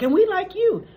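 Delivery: high, loud chirp of affection; mock gushing. Co-hosts telling Lois they like her, gushing.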